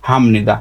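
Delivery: হামনিদা said low, with m before n; nasalised sound change. Shown as a demonstration. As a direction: model হামনিদা with m instead of p. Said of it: "এটা উচ্চারণ হবে". (হামনিদা, প নয় ম উচ্চারণে)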